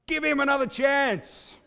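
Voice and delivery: high, slow yell from the back; shout. Retold or imitated. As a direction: call out across a stadium. Lone heckler yelling across stadium.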